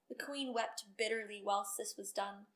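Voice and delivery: ordinary delivery, nothing marked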